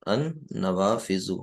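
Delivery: ان نوافذ said very slowly, each sound drawn out; teacher spelling out ان نوافذ slowly for the class; slow model reading. Shown as a demonstration.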